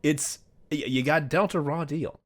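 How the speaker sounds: usual speaking voice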